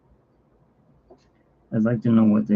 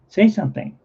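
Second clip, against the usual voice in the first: talking to the cat; 9 semitones higher than usual. Real, not acted. Coaxing the cat to talk.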